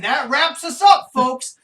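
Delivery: loud, slow showman sign-off; sign-off catchphrase. Shown as a demonstration. Host pitching a running sign-off gag, a slow loud showman read. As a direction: boom the catchphrase out. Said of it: "a thing every time I say".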